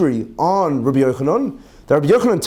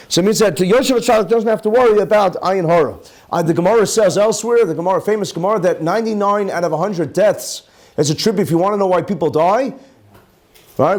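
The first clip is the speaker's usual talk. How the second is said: ordinary talk, nothing marked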